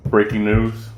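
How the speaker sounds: punched-up bulletin opener; mock news announcer. Mock headline announcing a schedule update.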